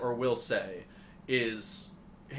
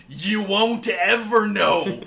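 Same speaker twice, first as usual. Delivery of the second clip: slow, loud, much higher theatrical taunt; dramatic villain; 8 semitones higher, 12 dB louder, much slower than usual. Retold or imitated. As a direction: ham it up like a cartoon rival. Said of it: "And he's like".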